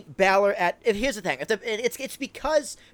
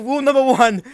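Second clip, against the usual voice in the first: ordinary talk, nothing marked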